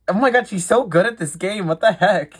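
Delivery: high, incredulous exclamation; amazed outburst. Real, not acted. Game host reacting to a player's win, amazed.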